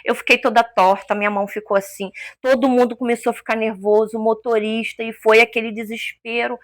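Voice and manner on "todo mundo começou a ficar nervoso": ordinary talk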